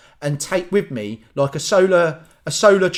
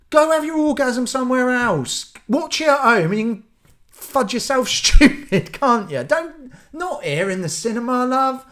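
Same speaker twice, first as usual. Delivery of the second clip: high-pitched comic rant; exasperated telling-off; 8 semitones higher than usual. Acted. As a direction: scold a stranger in mock outrage. Telling off the moaning woman.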